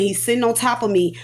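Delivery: ordinary delivery, nothing marked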